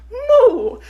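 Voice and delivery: high, laughing Italian-style moo; Italian cow moo. Pretend. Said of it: "Italian cows be like".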